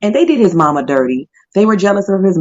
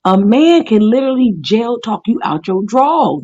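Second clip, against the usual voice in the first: ordinary delivery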